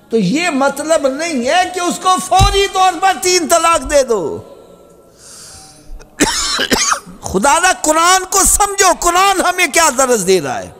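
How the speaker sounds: high, impassioned pulpit cry; full-force sermon voice; fiery preaching. Acted. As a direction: plead with the crowd from the pulpit. Preacher raises voice to plead.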